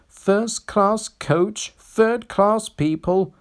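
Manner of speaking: high, slow, sniffy grumble; a prim coach looking down her nose; grumbling snooty coach. A narrator's reading. A haughty coach sneering at passengers. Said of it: "would grumble".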